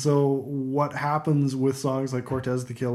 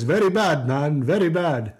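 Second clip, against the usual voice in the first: lightly put-on Jamaican accent; a deliberately bad Jamaican accent. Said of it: "bad Jamaican voice".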